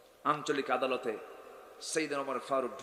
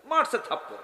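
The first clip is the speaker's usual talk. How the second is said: higher, punched delivery; punchline; 8 semitones higher, 4 dB louder than usual. Real, not acted. Preacher telling how the king slapped. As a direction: land the slap hard. Climax of the slap story.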